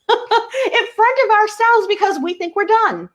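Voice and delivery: high-pitched, laughing through the words. Real, not acted. Healer joking to listeners about their own walls, laughing. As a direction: let the laugh lift the voice.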